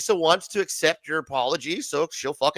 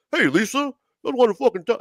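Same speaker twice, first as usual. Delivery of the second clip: higher-pitched put-on voice; impersonation; 5 semitones higher than usual. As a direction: mimic the guy, pitched up. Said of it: "[name], you sound like him".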